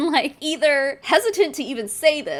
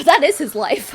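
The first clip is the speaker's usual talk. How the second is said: shocked; 3 semitones higher, 8 dB louder than usual. Real, not acted. Shocked reaction.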